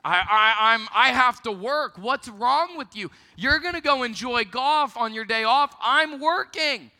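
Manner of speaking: hammed-up fed-up clerk rising to a high end; mock exasperated rant. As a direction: rant like the worker stuck at work.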